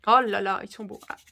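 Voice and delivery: ordinary talk, nothing marked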